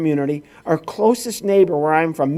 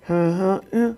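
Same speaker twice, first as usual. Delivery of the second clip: imitating a clerk's garbled grunt; mock counter-clerk mumble; unintelligible mumble; 4 dB quieter than usual. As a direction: mumble it so nobody could understand. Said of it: "he didn't understand her because nobody could".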